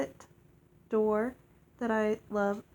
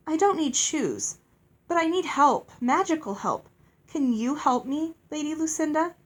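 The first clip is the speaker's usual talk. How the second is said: lightly voiced girl, higher and earnest; pleading girl; 6 semitones higher, 4 dB louder than usual. Girl asking a fairy for magical help, pleading. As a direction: appeal for help with pitch lifted.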